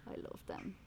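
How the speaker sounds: soft whisper to a dog. Real, not acted. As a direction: breathe it out gently. Whispering about a passing dog.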